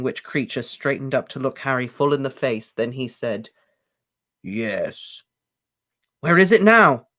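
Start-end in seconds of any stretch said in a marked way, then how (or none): 4.4-5.2 low, soft grudging elf yes; sullen elf. A narrator's reading. Elf grudgingly admits to Harry. 6.2-7.1 high, loud eager question; jubilant. A narrator's reading. Harry jubilantly asks the elf where the locket is. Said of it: "said Harry jubilantly".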